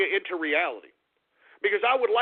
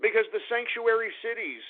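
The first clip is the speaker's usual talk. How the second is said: normal talking voice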